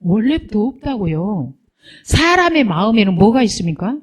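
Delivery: usual speaking voice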